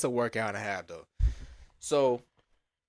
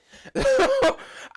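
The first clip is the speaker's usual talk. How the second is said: high, loud laugh; burst of laughter; 7 dB louder than usual. Real, not acted. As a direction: laugh hard at your own blunder. Laughs at his own predicament.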